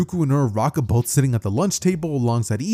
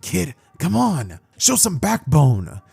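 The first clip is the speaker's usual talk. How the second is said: slow, drawn-out urging; unhurried prodding; slower than usual. Acted. Mentor to a stiffly walking boy, slow exhortation. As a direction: stretch each phrase as a nudge.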